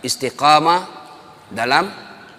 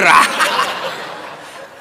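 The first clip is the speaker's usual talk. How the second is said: burst of laughter; 4 semitones higher, 3 dB louder than usual. Real, not acted. Punchline of a funny story.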